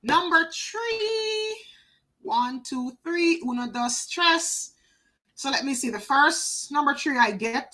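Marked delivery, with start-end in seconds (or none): 0.0-1.6 drawn-out call. Real, not acted. Announcing dice roll.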